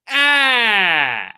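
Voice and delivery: big amazed gasp on entering a casino; shocked gasp. Shown as a demonstration. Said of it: "you usually go".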